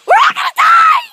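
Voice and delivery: high mock-terror shout; hammed-up panic. Birds pooping, playful panic.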